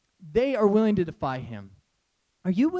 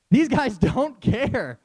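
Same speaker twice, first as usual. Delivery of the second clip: laughing emphasis; 4 semitones higher, 6 dB louder, slower than usual. Real, not acted. Preacher repeating the point, laughing.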